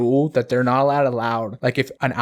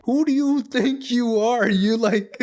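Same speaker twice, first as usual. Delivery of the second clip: high, mock-angry teacher voice; imitating an outraged teacher; teacher's angry glare; 9 semitones higher than usual. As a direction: toss it off like an offended teacher. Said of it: "like, anger in that moment's like".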